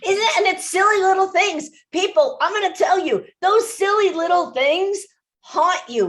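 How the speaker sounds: rising passion to warn the audience; fired up. Real, not acted. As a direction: voice jumps high and loud, urgent. High, loud emphatic urging.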